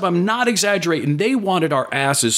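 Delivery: usual speaking voice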